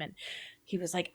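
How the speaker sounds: ordinary delivery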